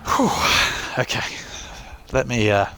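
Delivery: winded, catching breath between words; breathless after a long ramble. Real, not acted. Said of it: "let me catch my breath for a second".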